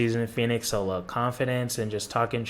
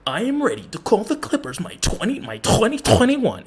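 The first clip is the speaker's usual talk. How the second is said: high, louder mock of a smooth host voice; mocking imitation; 12 semitones higher, 4 dB louder than usual. Mocking the YouTuber's line in a high parody voice. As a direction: parrot his line in a high sneering voice. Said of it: "why do you sound like that".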